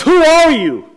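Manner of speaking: high, loud imitated shout of an armed man; angry yell. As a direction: yell it like a checkpoint gunman. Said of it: "he's yelling at him".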